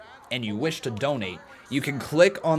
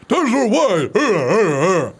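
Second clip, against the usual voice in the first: loud, drawn-out name yell; Titus O'Neil impression; 7 semitones higher, 8 dB louder, much slower than usual. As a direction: belt the name out slowly like Titus. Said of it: "my Titus, uh, O'Neil impression".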